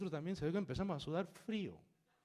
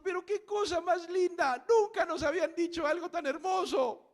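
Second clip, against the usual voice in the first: high, loud, overwhelmed admiration; gushing praise, imitated; 11 dB louder than usual. Moved woman and child praise the reading, high loud gushing. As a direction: cry it out as deeply moved listeners.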